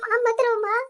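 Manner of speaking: crying while comforting; grief-stricken, words broken by sobs; tearful consoling. Acted. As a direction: console her through your own tears. Weeping daughter consoles her grieving mother.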